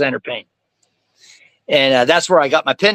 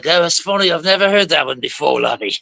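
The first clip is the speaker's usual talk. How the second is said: Jack Sparrow. Retold or imitated. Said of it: "I even did Jack Sparrow".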